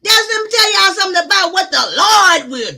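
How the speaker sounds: high, loud shout of triumph; a loud, high cry of victory; triumphant shout. Real, not acted. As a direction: shout it like a miracle just happened. Climax, found tooth.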